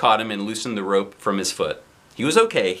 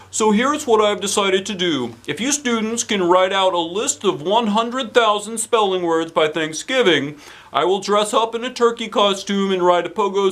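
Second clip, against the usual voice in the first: principal voice; 9 semitones higher, 3 dB louder than usual. Acted. Principal praises the class in a hammy voice. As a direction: boom it out like a cheery principal.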